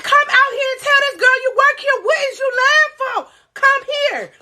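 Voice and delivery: high, pushy demand to come out; friend scolding him. Retold or imitated. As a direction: imitate her calling him out to the back. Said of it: "She said".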